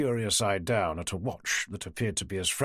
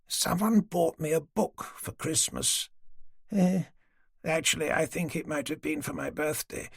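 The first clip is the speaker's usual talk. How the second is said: put-on higher, frail old woman's voice; an old lady patiently humouring the police; elderly lady; 6 semitones higher than usual. Old woman Dorothy gently agreeing to talk, higher old voice. Dorothy chatting about her book.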